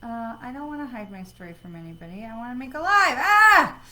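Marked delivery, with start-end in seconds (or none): 3.2-3.8 a high, loud wordless cry of exasperation; real frustrated outburst; frustrated yell. Real, not acted. Frustrated by app settings.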